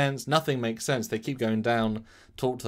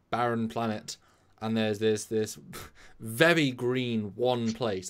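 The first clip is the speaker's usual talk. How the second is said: ordinary talk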